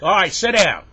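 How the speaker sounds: loud, high order to sit; exasperated teacher. Acted. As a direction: cut the pupil off with a shout. Exasperated teacher dismisses pupil.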